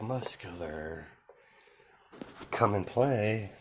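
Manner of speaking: slow, soft, menacing call; creepy taunt. Acted. Axe-wielding fighter calls out Muscular, slow soft taunt. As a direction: draw it out softly like a stalking killer. Villain taunts his prey.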